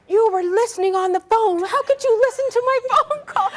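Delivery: high, loud accusing outburst; mock indignation. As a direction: imitate an outraged brother, laughter creeping in. Acting out brother's indignant accusation.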